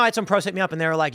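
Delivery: ordinary talk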